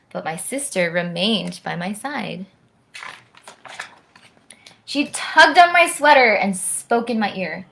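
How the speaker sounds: lively rhyming read-aloud; bouncy storyteller voice for a children's book; story narration. A narrator's reading. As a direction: read it like a bedtime rhyme to kids. Reads rhyming picture book aloud.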